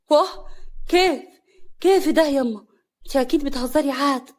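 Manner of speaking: high, startled exclamations; shocked disbelief. Acted. Daughter shocked by mother's revelation. (بصدمة وعدم تصديق)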